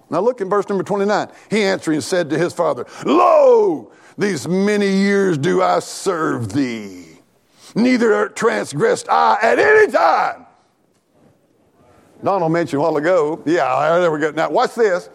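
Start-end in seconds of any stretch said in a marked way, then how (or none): 3.0-10.4 loud, drawn-out, declaimed complaint; self-righteous protest. Acted. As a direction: proclaim it grandly, stretching the words. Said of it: "he's self-righteous".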